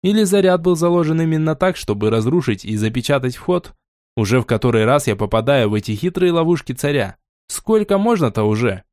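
ordinary delivery

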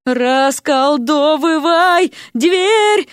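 very drawn-out high yell; slow, high bawled order; wailing yell. A narrator's reading. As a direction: stretch every syllable into a howl. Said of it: "вопила Вася в промежутках между прыжками".

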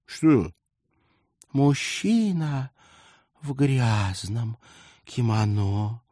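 high, slow, dreamy gushing. A narrator's reading. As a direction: swoon over him breathlessly. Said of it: "мечтательно выдохнула".

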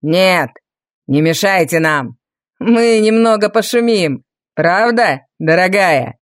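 low, mocking villain sneer. A narrator's reading. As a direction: sneer it with cruel mockery. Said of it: "С издёвкой произнёс Валериан".

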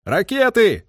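high-pitched sudden cry; excited call-out. A narrator's reading. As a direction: call out the sighting. Officer calls out sighting rockets.

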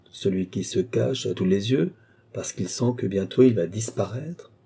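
plain reading voice

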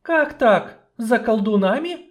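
high, loud, shocked questions; startled sorceress. Acted. Sorceress startled by the dwarf's news, high and alarmed. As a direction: catch your breath in alarm.

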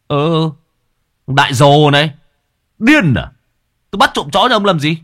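high, brash youth mocking an elder; cheeky mockery. Village boy brushes off the old man, brash and mocking. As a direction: scoff at him like a cocky village boy.

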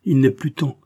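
sudden emphatic protest from a penitent son; exclaimed. A narrator's reading. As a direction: cry out in acted despair. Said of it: "s'écria Raoul".

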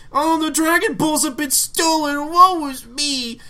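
high, slow, melodramatic lament; hammy stage actor. Retold or imitated. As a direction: wail it like a tragic heroine. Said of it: "overdramatic and the spotlight's on her".

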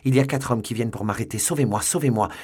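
loud, high, panicked plea; urgent, frightened shouting; desperate cry. A narrator's reading. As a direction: beg for rescue as pursuers close in. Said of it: "s'écria-t-il".

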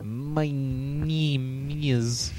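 slow, drawn-out mock Russian accent. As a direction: stretch it out like a Russian guy. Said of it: "he started talking in that Russian accent".

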